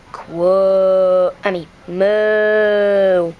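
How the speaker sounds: a cow fixing its own mistake; slow moo. Acted. Cow correcting itself to the duck, slow and low.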